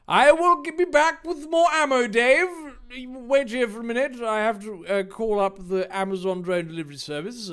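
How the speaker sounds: high, loud, hurried villain promising to return; hammed-up baddie fleeing; retreating villain. Villain Jake retreating, fast.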